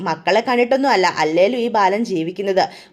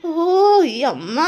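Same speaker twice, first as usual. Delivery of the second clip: through gritted teeth; 4 semitones higher, much slower than usual. Retold or imitated. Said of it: "പല്ല് കടിച്ചുകൊണ്ട് പറയാണ്".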